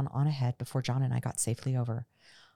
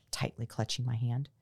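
ordinary delivery

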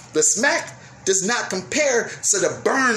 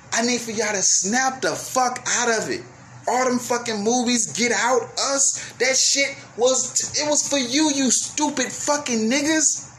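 raised, slowed angry rant; yelling at viewers; 5 semitones higher than usual. Real, not acted. Scolding his audience over the movies, angry raised rant. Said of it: "I wasn't gonna yell at y'all".

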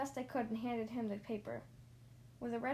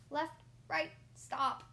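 usual speaking voice

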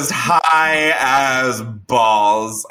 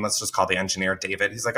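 ordinary talk, nothing marked

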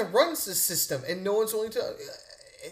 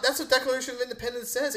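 normal talking voice

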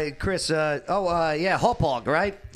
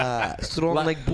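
mock Russian accent. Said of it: "you sound Russian right there".

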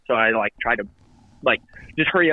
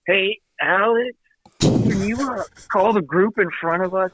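higher, drawn-out call from a distance; imitated holler; 7 semitones higher, slower than usual. Golfer calling his buddy from the weeds, mock holler. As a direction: call out a favor, mildly hollered. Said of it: "Calvin just hollers from the weeds".

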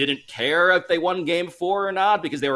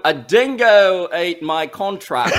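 put-on Australian accent; 3 semitones higher, 5 dB louder, slower than usual. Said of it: "can you say that last part in an Australian accent".

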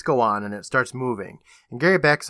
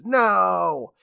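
drawn-out, higher mock scream; 5 semitones higher than usual. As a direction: stretch out a restrained imitation scream. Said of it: "the kid screams".

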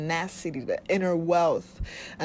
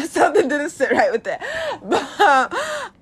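loud, high, laughing through the words; 11 semitones higher, 10 dB louder, slower than usual. Real, not acted. Laughing at herself about liking discipline, loud. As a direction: crack up at your own awkward confession.

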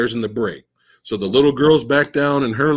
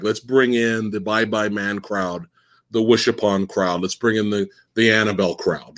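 studio boss voice; 7 semitones lower than usual. Retold or imitated. Mocking studio bosses' pitch.

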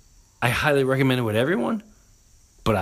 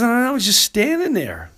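quoted wife; 12 semitones higher, 3 dB louder, faster than usual. Retold or imitated. Wife quoting herself.

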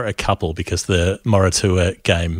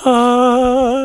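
high sustained angelic note; heavenly choir "ahh"; 5 dB louder than usual. Acted. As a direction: sing out a mock heavenly fanfare. Imitates heavenly shaft of light.

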